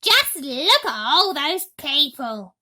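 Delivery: loud, slow, greedy gloating; greedy Aunt Spiker. A narrator's reading. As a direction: gloat over the crowd. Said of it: "Aunt Spiker was saying".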